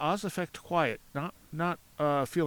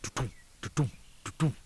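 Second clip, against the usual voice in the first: thumping sound; 2 semitones lower than usual. Retold or imitated. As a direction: mimic footfalls thudding in the ground. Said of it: "you're hearing on the ground".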